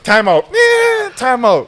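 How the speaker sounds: high, loud call; calling timeout. Retold or imitated. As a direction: imitate a shouted timeout on the field.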